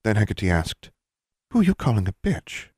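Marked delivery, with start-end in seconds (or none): 1.5-2.7 higher, a touch of edge; a mild edge under the dullness; slight edge. A narrator's reading. Mother answering her daughter's insult with a slight edge. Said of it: "a slight edge in her voice".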